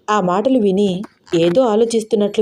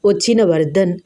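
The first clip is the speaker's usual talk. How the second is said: ordinary delivery, nothing marked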